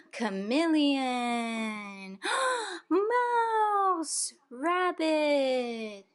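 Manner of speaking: big gasps and high excited naming. Real, not acted. Reading picture book to toddler.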